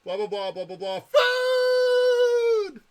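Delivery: droning blah then shouted food; dog hearing only food. Retold or imitated. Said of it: "And the dog hears".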